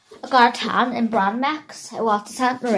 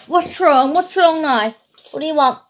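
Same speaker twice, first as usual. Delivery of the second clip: pet talk to dog; 6 semitones higher, 5 dB louder than usual. Real, not acted. Asking the dog.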